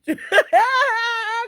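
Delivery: high, loud laugh with a crying edge; helpless real laughter; burst of laughter. Real, not acted. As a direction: laugh till it almost cries.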